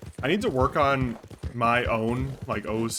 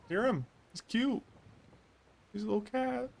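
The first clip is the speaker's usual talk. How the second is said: cutesy cooing; 11 semitones higher, 6 dB quieter than usual. Real, not acted. Streamer baby-talking to a cat in town, cutesy and high.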